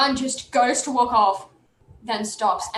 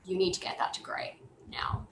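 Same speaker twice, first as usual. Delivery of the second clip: whispered warning, very soft; urgent whisper; 3 semitones lower, 12 dB quieter than usual. Acted. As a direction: whisper it as a pressing order. Character urgently warns the party.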